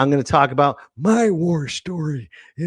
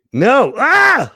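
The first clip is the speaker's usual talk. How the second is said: sudden high frustrated cry; frustrated yelp; 11 semitones higher, 6 dB louder than usual. Real, not acted. Frustrated camera fail.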